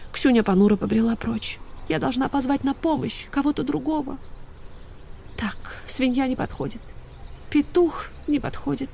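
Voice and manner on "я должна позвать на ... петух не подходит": soft, higher inner voice; small, soft, pondering voice; hushed musing. A narrator's reading. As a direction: think it over quietly to yourself. Dog thinks dejectedly to herself.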